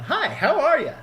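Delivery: high, loud overly cheerful hello; chirpy greeting. Pretend. Teasing missionaries, a high overly cheerful greeting. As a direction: greet them like a keen door-knocker. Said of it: "walking up to missionaries going".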